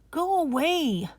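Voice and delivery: put-on high, sharp girl's "go away"; a young sister shouting at her brother through the door; child's shout. Sister shouting her brother away. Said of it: "Aisha shouted".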